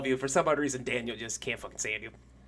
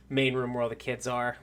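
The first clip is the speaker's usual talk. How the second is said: ordinary talk, nothing marked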